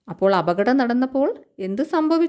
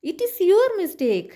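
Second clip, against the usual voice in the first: high, slow mock accusation; angry bus; 5 semitones higher, much slower than usual. Bus blames the car after the crash, mildly angry accusation. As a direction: blame the other, mildly cross. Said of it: "ബസ്സിന് ദേഷ്യം വന്നിട്ട്".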